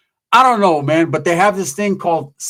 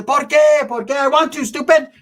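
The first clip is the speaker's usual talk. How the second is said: imitating the paisa; 10 semitones higher than usual. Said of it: "The paisa says".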